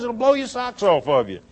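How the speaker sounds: ordinary talk, nothing marked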